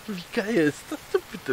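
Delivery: hoch und lachend, total begeistert. Real, not acted. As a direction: mit einem Lachen überschwänglich wiederholen. (lachend begeistert)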